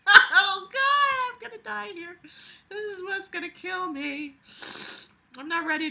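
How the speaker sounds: high, squeaky voice through laughter; barely getting words out for laughing; laughing fit. Real, not acted. Laughing, dying from the story.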